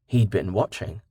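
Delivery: ordinary delivery, nothing marked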